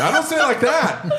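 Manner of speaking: high-pitched protest through laughter; laughing protest. Real, not acted. Shop owner protests the mimicry, amused and high-pitched. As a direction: object while cracking up, voice jumping up.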